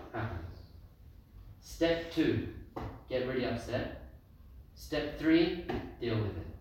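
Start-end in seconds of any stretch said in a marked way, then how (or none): none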